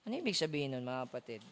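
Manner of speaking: normal talking voice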